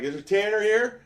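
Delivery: challenging call. Retold or imitated. Confronting at hockey house.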